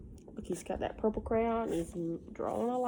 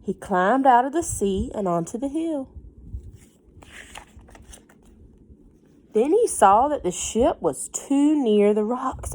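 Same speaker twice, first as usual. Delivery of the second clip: put-on lively read-aloud voice for a child; story narration; 7 dB louder than usual. A narrator's reading. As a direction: read it like a bedtime book.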